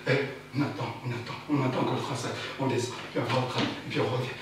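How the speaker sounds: low, soft tired monotone; weary monotone. Acted. Commuters waiting on the train, droning wearily. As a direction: drone it like a worn-out commuter.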